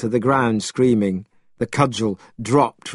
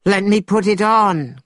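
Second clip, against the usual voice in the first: high, excited plea; eager cry; 10 semitones higher, 4 dB louder than usual. A narrator's reading. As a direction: burst out eagerly, pitch way up. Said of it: "cried Monkey".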